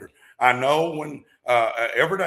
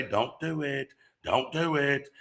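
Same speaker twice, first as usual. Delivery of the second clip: voice of fear; 4 dB quieter, slower than usual. Retold or imitated. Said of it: "Fear's gonna say".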